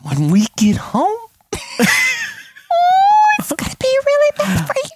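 high, slow, drawn-out warning; mock ominous threat. Dad warning daughter about home, strained menace. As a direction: imitate a parent straining through a threat.

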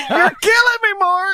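very high, loud amused protest; laughing protest. Real, not acted. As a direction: protest helplessly through laughter. Teasing protest amid laughter.

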